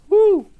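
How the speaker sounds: sudden loud, high "woo"; loud falsetto-high woo; high whoop. Real, not acted. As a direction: let out a quick high whoop.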